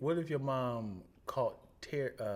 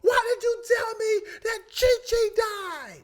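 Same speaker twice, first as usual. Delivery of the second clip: high, loud crying yell; mock distraught yell; 11 dB louder than usual. [name] yelling at son Sean, high crying yell. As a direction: scold him through mock tears.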